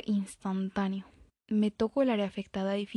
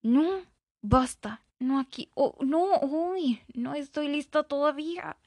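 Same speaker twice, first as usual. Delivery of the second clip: put-on higher, pleading girl's protest; complaining girl; 5 semitones higher, slower than usual. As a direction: push him away, voice rising. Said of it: "se está quejando de algo". (chica quejándose, más aguda)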